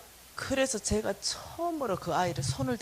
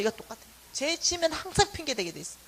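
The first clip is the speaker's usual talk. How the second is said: ordinary delivery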